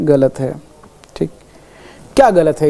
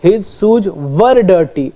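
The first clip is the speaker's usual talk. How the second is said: very slow, word by word; teacher slowly reading the corrected sentence; slow dictation; much slower than usual. Shown as a demonstration. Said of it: "गलत".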